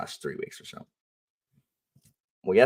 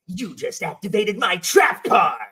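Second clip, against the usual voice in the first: higher, loud, slow triumphant taunt; gloating villain shout; 11 semitones higher, 4 dB louder, slower than usual. Acted. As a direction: gloat like a card game villain. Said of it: "I say".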